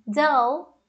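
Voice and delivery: "Dull" with an open UH, rising; contrasted vowel. Shown as a demonstration. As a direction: say "Dull" like DAL, awaiting its contrast. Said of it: "A vogal é diferente". ("Dull" com vogal aberta, DÂL)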